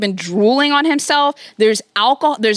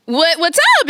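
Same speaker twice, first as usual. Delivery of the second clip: put-on bright girlish greeting; 7 semitones higher than usual. The girlfriend greeting, raised pitch.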